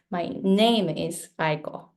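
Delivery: "my name is Aiko" stressing NAME and Aiko; two stresses. Shown as a demonstration. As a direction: beat twice, on NAME and Aiko, in "my name is Aiko". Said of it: "I feel the stressed syllable twice".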